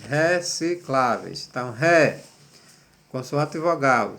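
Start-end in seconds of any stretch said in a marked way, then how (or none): 0.0-1.5 "Recicláveis" slowly broken into its syllables. Shown as a demonstration. Teacher showing pupils the syllables, "Recicláveis" slowly syllable by syllable. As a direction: split "Recicláveis" slowly, one syllable at a time. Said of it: "composição das sílabas das palavras seguintes".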